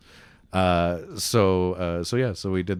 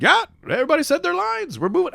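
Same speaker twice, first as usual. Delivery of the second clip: high, louder offhand director; breezy director; 7 dB louder than usual. Retold or imitated. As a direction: brush it off casually. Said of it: "he's just like".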